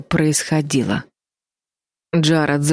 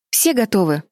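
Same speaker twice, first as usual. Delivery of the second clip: mildly brusque, pitch raised; sharp question; 4 semitones higher than usual. A narrator's reading. Betty checks everyone is ready to escape, a touch sharp. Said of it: "резко спросила Бетти".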